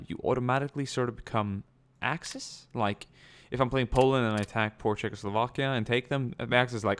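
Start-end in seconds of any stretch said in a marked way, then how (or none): none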